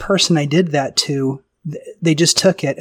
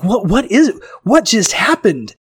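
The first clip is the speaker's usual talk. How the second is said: higher, excited, mock astonished; stunned after the hug, half laughing; amazed disbelief; 6 semitones higher, 3 dB louder than usual. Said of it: "And I was like".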